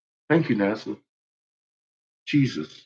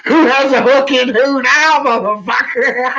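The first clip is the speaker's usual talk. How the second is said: gleeful taunt; 10 semitones higher, 6 dB louder than usual. Real, not acted.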